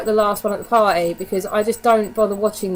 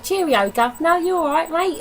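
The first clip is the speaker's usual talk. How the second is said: a playful fake Cockney, a bit higher; mocking the American take on a London accent; 8 semitones higher than usual.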